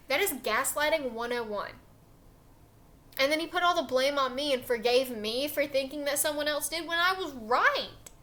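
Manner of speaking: ordinary delivery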